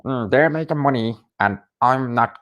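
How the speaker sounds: lightly attempted squeaky, raspy voice; Barry McGuigan. Fan imitating a bitter boxing pundit, lightly squeaky and raspy. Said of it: "He has that squeaky, raspy voice".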